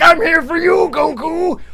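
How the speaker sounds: high, loud anime challenger; hammed-up anime threat; Dragon Ball Z villain. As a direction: call out the hero like a cartoon nemesis. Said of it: "like a fucking Dragon Ball Z thing".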